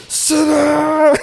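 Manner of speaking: very high, loud screaming order; screamed command. Retold or imitated. Man screaming at the guest to sit down, furious. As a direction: scream it like a madman. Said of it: "that little vein in his forehead".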